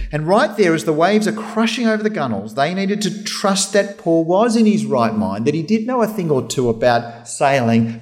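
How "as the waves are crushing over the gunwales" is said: normal talking voice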